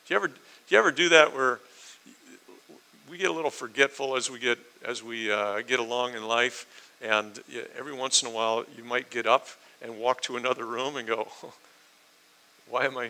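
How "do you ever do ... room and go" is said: normal talking voice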